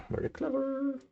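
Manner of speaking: mock-impressed, airy and high; a squeaky falsetto compliment to the puzzle; high squeaky praise. Real, not acted. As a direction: jump way up into a falsetto for it. Admiring a clever Go move.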